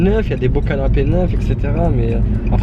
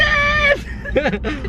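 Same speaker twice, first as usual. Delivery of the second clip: high laugh. Real, not acted. Laughs at en secrète.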